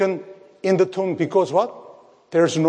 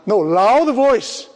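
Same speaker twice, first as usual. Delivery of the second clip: "loud" stretched long, higher and a little louder; emphatic stress on loud; 5 semitones higher, 4 dB louder, slower than usual. Real, not acted. Preacher correcting "small voice" to the congregation, emphatic "loud". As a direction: lean hard and slowly on "loud".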